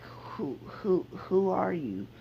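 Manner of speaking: put-on a small boy meeting a stranger, a touch timid; five-year-old Naruto. Little Naruto asks the stranger who he is, lightly voiced small child. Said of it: "this is like a five-year-old Naruto".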